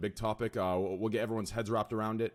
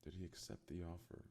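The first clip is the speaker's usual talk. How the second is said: much softer, thinking aloud under his breath; muttering to himself; 5 semitones lower, 20 dB quieter than usual. Shown as a demonstration. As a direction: mumble the question while pondering it. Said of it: "Let me think, guys".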